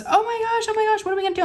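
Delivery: very high-pitched mock panic; panicked. Daughter hearing of a mouse, panicked.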